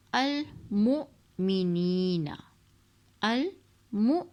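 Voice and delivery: المؤمنین very slow, glottal jolt on the hamza; slow recitation with catch. Shown as a demonstration. Teacher reciting المؤمنین with a hamza jolt. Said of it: "جھٹکا ہم یہاں دیں گے".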